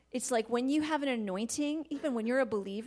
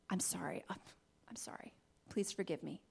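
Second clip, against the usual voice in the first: soft humble sorry; quiet apology; 5 semitones lower, 11 dB quieter than usual. Shown as a demonstration. Parent to child, quiet humble apology. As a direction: say it low and humble. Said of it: "be quick to say".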